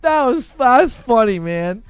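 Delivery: laughing. Real, not acted. Streamer tickled by chat's joke, laughing. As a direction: say it through the tail of a laugh. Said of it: "that was a good laugh".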